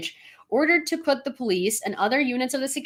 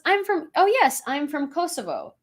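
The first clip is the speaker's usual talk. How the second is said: cheerful answer; 7 semitones higher than usual. Retold or imitated.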